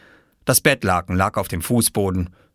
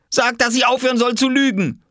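high-pitched, worked-up demand; angry child's yell; 4 dB louder than usual. Acted. Oskar appealing to Mama while charging Jule, angry yell. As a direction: demand it like a fuming small boy.

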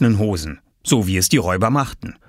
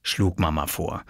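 ordinary delivery

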